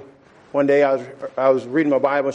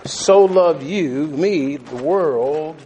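slowed for emphasis; much slower than usual. Acted. Slow emphatic preaching.

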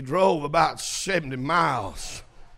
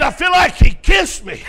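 high, very loud elated outcry; preacher shouting as the overjoyed man; joyful shout; 11 semitones higher, 11 dB louder than usual. Retold or imitated. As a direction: burst out with acted joy. Acting saved man's joyful outcry.

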